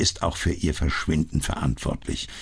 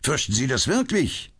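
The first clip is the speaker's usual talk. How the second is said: bitter; 9 semitones higher than usual. A narrator's reading. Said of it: "stieß Lestrade erbittert aus".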